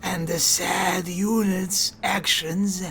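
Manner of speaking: slow, whispery mechanical hiss. Acted. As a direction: hiss each word slowly like a machine priest. Keeps the tech priest voice.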